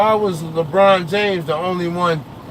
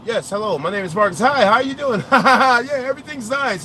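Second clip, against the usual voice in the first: higher, polite, over-friendly phone voice; 6 semitones higher than usual. Shown as a demonstration. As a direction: sound harmless and cheery for the broker.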